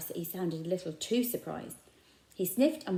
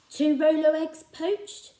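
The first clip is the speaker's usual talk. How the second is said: higher, louder and slow, offering kindly; coaxing shopkeeper; 8 semitones higher, 8 dB louder, slower than usual. A narrator's reading. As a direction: tempt him with breakfast. Raj offering odd breakfasts.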